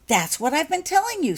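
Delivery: high, loud and impatient; exasperated, she's said it already. Acted. Becky to half-awake Holly, loud impatient exasperation. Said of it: "said Becky impatiently".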